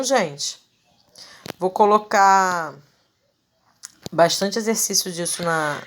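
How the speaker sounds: normal talking voice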